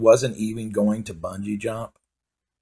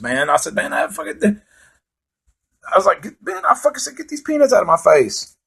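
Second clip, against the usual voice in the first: indignant high rant; 10 semitones higher than usual. Retold or imitated. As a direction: squeal in outrage about the peanuts. Indignant retold zoo peanuts rant.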